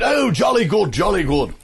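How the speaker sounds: high, swooping British approval; imitating actor's voice. Churchill actor approving the imitation, in his high British voice. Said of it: "that was his real voice".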